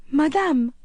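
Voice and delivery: put-on high, loud boy's cry of shock; startled young boy calling out. A narrator's reading. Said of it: "he cried in a shocked voice".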